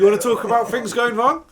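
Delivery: high, loud retold rant; having a go. Husband takes on the booing crowd, having a go. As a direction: imitate an angry MC going off at the crowd. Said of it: "so he's having a go".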